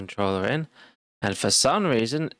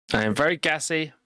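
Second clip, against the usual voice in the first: usual speaking voice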